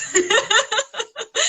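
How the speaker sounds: loud, high burst of real laughter. Real, not acted. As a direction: break into a bright laugh.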